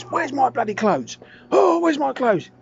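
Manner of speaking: loud outraged wail; indignant yell. Retold or imitated. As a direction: bellow after the missing clothes. Naked man demanding his clothes.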